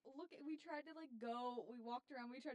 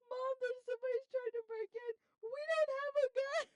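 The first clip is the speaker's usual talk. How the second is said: loud, high-pitched imitation of a frantic yell; panicked yell; 11 semitones higher, 10 dB louder than usual. Imitating friend yelling to mom about a break-in, panicked yell. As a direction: yell it at full tilt, imitating a scared friend. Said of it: "she yells".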